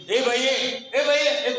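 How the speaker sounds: loud high calls of "ए बाई"; shouting woman. Retold or imitated. Village mother calls out to the teacher, loud shout. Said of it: "ओरडली".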